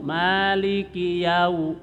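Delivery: Maliki yau- joined slowly without any nasal hum; correct linking. Shown as a demonstration. Teacher models the correct link for students. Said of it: "disambung tapi tidak pakai dengung".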